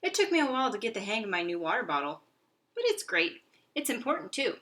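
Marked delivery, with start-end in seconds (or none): 2.8-4.6 high, loud delight; overjoyed little hamster; squeaky enthusiasm. Acted. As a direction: gush about it. Hamster praises water bottle.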